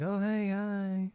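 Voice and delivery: high, slow, hesitant hello; awkward greeting. Retold or imitated. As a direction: greet awkwardly, stretching each word. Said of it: "this, like, little awkward moment".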